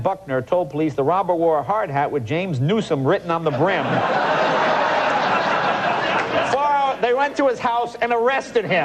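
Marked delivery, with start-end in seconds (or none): 3.9-6.5 airy helpless laugh; breathy laugh. Real, not acted. Host laughs at the robber's name on his hat, airy. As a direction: break up at the dumb robber.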